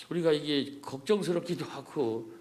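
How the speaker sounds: ordinary talk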